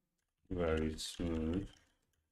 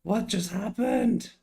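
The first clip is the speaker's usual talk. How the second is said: shocked; 12 semitones higher, 11 dB louder, slower than usual. Real, not acted. Stream tool suddenly breaks.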